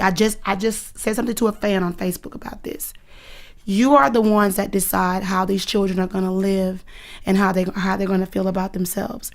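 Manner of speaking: ordinary talk, nothing marked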